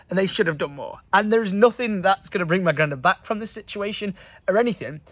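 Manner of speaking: ordinary talk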